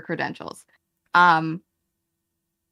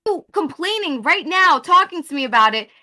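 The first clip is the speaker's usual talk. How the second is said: louder and higher, building frustration; heated rising complaint; 6 semitones higher, 5 dB louder than usual. Real, not acted. Arguing with the streamer, getting louder and higher. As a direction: push back hard, voice climbing.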